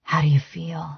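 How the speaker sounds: soft intimate whisper. A narrator's reading. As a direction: breathe the question close to his ear. Intimate question to lover.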